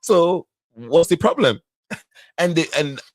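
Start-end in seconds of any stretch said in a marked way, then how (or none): 0.0-1.6 put-on excuse-making African-accented husband, rising higher; imitated African man, pitching up insistently. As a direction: explain it away in an African accent. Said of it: "That man said".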